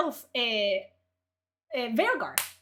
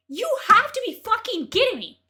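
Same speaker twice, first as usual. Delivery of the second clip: higher, louder disbelief; outraged shout at the critic; 8 semitones higher, 6 dB louder than usual. Real, not acted. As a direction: yell it in disbelief. Outraged repeat.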